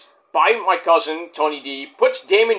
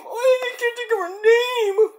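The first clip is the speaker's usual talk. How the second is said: high, wailing mock cry. Real, not acted. Stuck on a rapper's name late at night, high mock wail. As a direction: wail it like a fake sob, pitched way up.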